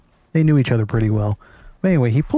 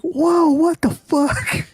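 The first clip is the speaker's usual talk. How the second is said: high, slow, squealing mock disbelief; shocked yelp; much slower than usual. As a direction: recoil in fake horror as you back away. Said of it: "We're like".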